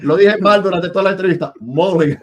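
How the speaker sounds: high, loud, breaking into laughter; amused dismay. Real, not acted. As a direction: exclaim it, laughing at your own blunder. Amused at mispronouncing the guest's name. (exclamación aguda y fuerte, muerto de risa)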